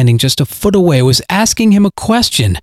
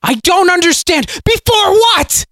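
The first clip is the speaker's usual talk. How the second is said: frantic cry. Acted. Frantic repeated questioning.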